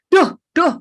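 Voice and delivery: a quick irritated "duh, duh"; annoyed groan. Retold or imitated. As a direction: huff at the driver who cut in. Imitating annoyance at being cut off.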